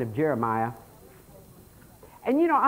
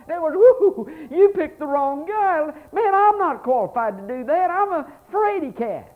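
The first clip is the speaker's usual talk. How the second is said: mock fear; 12 semitones higher, 4 dB louder than usual. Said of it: "it scared him to death".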